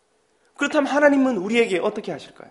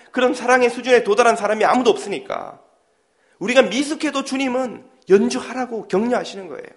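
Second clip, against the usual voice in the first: usual speaking voice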